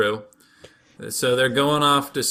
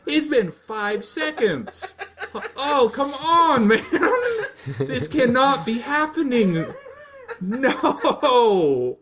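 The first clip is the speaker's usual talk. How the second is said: high-pitched, laughing disbelief; hammed-up wail of protest; exasperated outrage; 11 semitones higher, slower than usual. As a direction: protest in comic despair, voice pitched way up. Acts out own outraged reaction.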